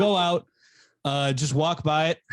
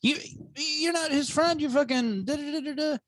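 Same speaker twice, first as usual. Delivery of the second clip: mocking her rant; 14 semitones higher than usual. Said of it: "just been like".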